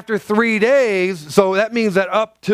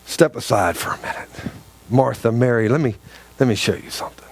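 loving; 8 semitones lower, faster than usual. Retold or imitated. Jesus gently asking Martha and Mary to step aside, lovingly. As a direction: speak to the sisters tenderly. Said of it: "how lovingly he did this".

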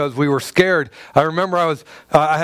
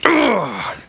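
frustrated groan; 5 semitones higher than usual. Retold or imitated. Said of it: "I'm like".

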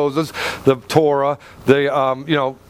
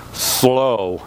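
"Slow." dropped low after a beat; punchline pause; 6 semitones lower than usual. Shown as a demonstration. Pastor delivering the punchline to the congregation, low and deadpan. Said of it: "you ready?".

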